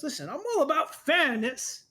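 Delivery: a teasing captor pinning the hero in place; smug villain. Acted. Villain speech.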